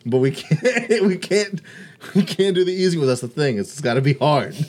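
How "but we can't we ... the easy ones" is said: laughing through the words, higher. Real, not acted. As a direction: giggle it out while talking. Laughing about doing accents.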